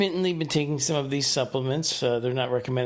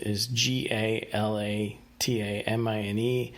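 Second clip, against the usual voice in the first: ordinary delivery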